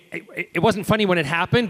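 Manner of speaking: ordinary delivery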